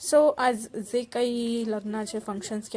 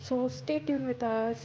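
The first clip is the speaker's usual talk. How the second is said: very slow, stretched stay tuned; drawn-out sign-off; 3 semitones higher, much slower than usual. Acted. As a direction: linger on every word of the outro. Vlog sign-off to viewers. (खूप हळू, ताणून म्हटलेला निरोप)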